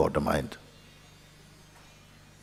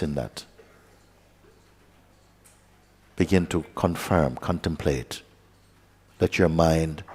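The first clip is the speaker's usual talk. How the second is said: soft, unhurried meditation guidance with long pauses; guided meditation; 3 dB quieter than usual. Acted. Teacher guiding students to rest as awareness, softly. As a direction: guide the listeners inward, quietly.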